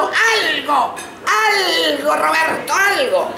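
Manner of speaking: high, drawn-out exasperated cry; desperate pleading. Acted. Desperate for any sound from tortoise.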